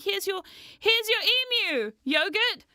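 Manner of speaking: lightly playful sing-out, as if handing over a gift; presenting to a child. Retold or imitated. Retold handing toy to small nephew.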